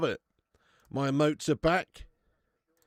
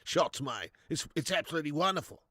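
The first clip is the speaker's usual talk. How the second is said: Big Ange. Pretend. Streamer doing an Aussie pundit on request, Australian impression. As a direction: play a broad Aussie football manager. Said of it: "Do an Aussie impression?".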